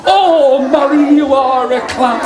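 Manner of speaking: loud, high sing-song tease; crowd's teasing call. Acted. Storyteller leading everyone in teasing Molly, high loud sing-song. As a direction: lead the taunt high and loud. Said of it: "Can you do that with me?".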